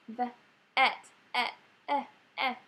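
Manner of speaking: put-on kids sounding "et, et, eh, eh"; sounding out words. Shown as a demonstration. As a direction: isolate the "eh" sound aloud. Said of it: "they're gonna say".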